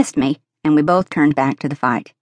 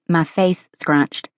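ordinary delivery, nothing marked

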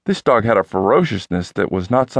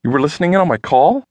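indignant; 6 semitones higher than usual. A narrator's reading. Indignant question to Carl.

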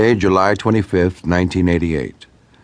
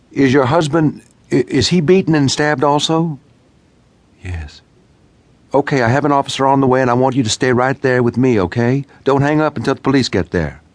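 higher, brisk, firm dispatcher voice; urgent dispatcher; 8 semitones higher than usual. A narrator's reading. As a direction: keep her on the line, urgent but steady. Dispatcher voice held.

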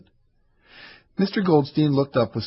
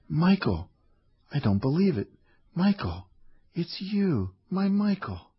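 gasping, high and soft; pitched higher and softer in stunned wonder; breathless disbelief; 7 semitones higher, 4 dB quieter, slower than usual. A narrator's reading. Old Hannah recognizing Michael after decades, breathless and high. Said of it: "She gasped".

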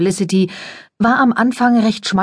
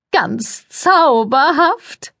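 very high, sugary society voice; gushing press lady; 8 semitones higher, much slower than usual. A narrator's reading. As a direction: coo it, all air kisses and fuss. Cordelia voiced in story.

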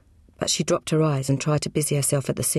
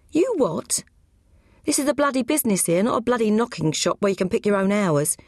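much higher, outraged father-in-law ranting; indignant butcher; 5 semitones higher than usual. Acted. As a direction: bluster at the lazy son-in-law. Grierson scolds Roy about skiving.

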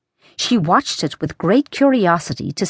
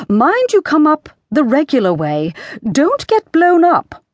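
high queenly call, rising higher; calling a warning; 8 semitones higher than usual. A narrator's reading. Queen warns the King below, calling out high.

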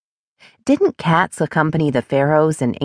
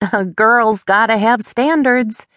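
higher, slower, lightly acted call back; laughing shout back; 6 semitones higher, slower than usual. A narrator's reading. Friend answering a jab about her car, playfully shouting back. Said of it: "she shouts back with a laugh".

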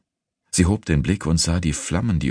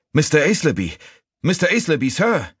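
high, urgent shout; urgent calling out; 12 semitones higher, 4 dB louder than usual. A narrator's reading. Sneaton calling his master by the fire, urgent high shout. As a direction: call out to get his attention.

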